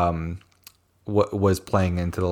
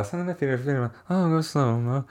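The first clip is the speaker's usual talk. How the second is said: soft, higher run-through of lyrics; muttered recall; 5 semitones higher, 7 dB quieter, much faster than usual. Real, not acted. Recalling the next lyric, muttered under the breath. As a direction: murmur it to yourself to find your place.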